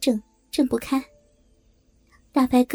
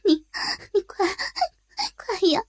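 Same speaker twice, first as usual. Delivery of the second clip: higher, crying plea; tearful pleading; 9 semitones higher, 4 dB quieter than usual. Acted. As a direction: beg through tears. 哭腔催促. (带着哭腔、声调升高地哀求)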